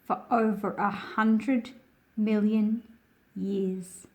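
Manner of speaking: projected read-aloud narration; reading to children. A narrator's reading. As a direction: read the picture book out to a class of kids. Reads picture book aloud.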